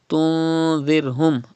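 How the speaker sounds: "tunzirhum" with a long nasal "tun". Shown as a demonstration. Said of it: "you have to read with [name]".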